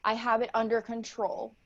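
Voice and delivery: ordinary delivery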